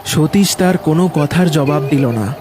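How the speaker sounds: usual speaking voice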